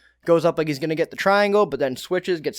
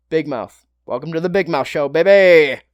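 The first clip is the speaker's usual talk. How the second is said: hype announcer; 6 dB louder than usual. Acted. Hype intro for Kevin Holland.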